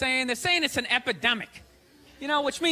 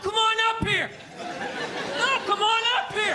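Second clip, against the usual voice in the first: taunting heckler; 9 semitones higher, slower than usual. Retold or imitated. Drunk fan daring the player, taunting. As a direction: call him into the stands. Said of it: "calling him up".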